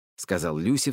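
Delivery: ordinary delivery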